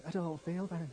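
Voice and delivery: light, soft impression with a North of England accent; Alan Bennett. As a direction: softly mimic a Northern English writer's voice. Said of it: "just imagine Alan Bennett saying it".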